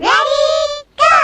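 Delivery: high, loud start call; excited shout. Real, not acted. Starting the spicy challenge.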